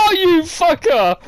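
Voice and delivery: high, drawn-out indignant cry; outraged outburst. Real, not acted. Player realising the others turned on him, outraged. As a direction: cry out at a betrayal. Outraged at being voted against.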